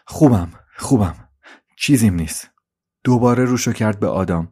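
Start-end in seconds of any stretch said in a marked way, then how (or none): 0.0-2.5 dazed, faltering denial in short bursts; stunned, trembling. Acted. Suzi, overwhelmed, insists nothing is wrong, stunned and trembling. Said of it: "با صدای بهت زده و لرزون".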